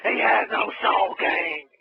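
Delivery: slow, high, loud rasping cry; outraged mock ringside announcer; wrestling commentator impression. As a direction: wail it like a scandalised commentator.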